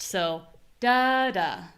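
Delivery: contrasting da beats; stressed da then weak da. Shown as a demonstration. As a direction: lean on the first, drop the second. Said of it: "We hear contrast".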